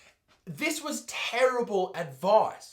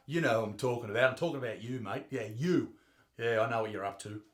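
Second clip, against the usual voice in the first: quiet mock accusation; 8 semitones lower, 6 dB quieter, faster than usual. Singling out someone in audience.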